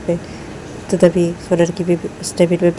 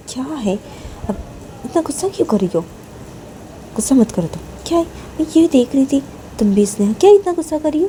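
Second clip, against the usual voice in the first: higher-pitched protest; calm an angry friend, a little fed up; mildly whiny, put-upon girl; exasperated, placating friend; 8 semitones higher, faster than usual. A narrator's reading. Voices placating Neha.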